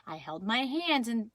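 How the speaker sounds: ordinary delivery, nothing marked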